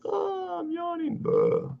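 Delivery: very high squeaky mock yawn. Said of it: "It's like".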